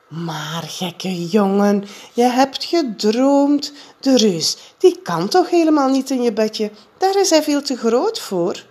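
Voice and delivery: put-on calm the scared boy down; soothing mother. A narrator's reading. Mother comforts scared Jip. (moeder troost Jip, langzaam en zacht)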